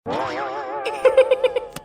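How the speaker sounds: a silent, wheezy burst of laughter; airy giggle at the joke; breathy laugh. Real, not acted. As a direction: laugh almost without voice.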